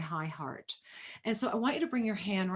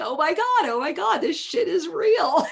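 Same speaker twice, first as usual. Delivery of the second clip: high, loud giddy squeal, breaking into a laugh; excited disbelief; 8 semitones higher, 7 dB louder than usual. Retold or imitated. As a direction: gush like you can't believe your luck. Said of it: "and you're like".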